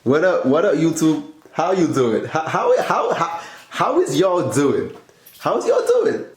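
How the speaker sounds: loud, high hype greeting; big energetic YouTube intro; hyped intro. Acted.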